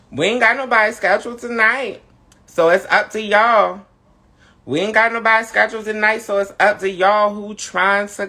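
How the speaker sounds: excited hype. Real, not acted. Host urging chat viewers to come on, excited and fast. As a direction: rush it out eagerly.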